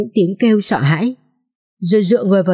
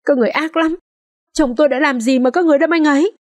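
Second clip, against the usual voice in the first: distraught accusation; 8 semitones higher, faster than usual. A narrator's reading. Wife accusing husband's killers.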